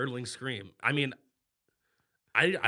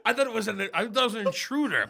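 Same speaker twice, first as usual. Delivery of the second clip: ordinary talk, nothing marked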